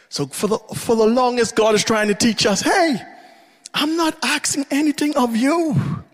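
high, pleading preacher's voice; impassioned sermon cry, near tears; full-force preaching. Real, not acted.

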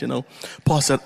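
ordinary delivery

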